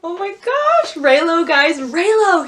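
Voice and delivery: high, loud squeal of delight; real fangirl excitement. Real, not acted. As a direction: squeal it to your fellow fans. Fan thrilled by Reylo.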